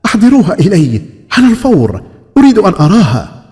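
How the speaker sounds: high, loud and overjoyed; excited. Acted. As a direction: call out in delighted command. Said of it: "ابتهج الملك وقفز سعيدا".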